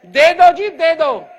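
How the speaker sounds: loud mock pleading "दे दो जी"; people begging. Speaker to the audience, mocking people begging for the knowledge, loud pleading. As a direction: imitate an eager crowd begging to be given it. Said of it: "फिर लोग हमसे कहते हैं".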